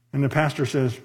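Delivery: usual speaking voice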